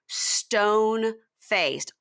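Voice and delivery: "stone-faced" stretched out very slowly; drawn-out emphasis. Real, not acted. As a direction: draw out "stone-faced" for dramatic weight.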